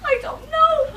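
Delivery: high, wailing upset; tearful. Acted. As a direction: blurt the bad news on the edge of crying. Jilted woman breaks the news.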